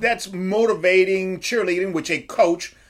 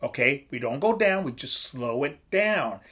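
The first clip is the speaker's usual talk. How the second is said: soft, steady and reassuring, brought way down; calm coaching tone; 4 semitones lower, 6 dB quieter than usual. Shown as a demonstration. Coach calming his fighter before a fight, soft reassurance. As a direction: settle him like a coach easing a fighter. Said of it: "See how I'm talking?".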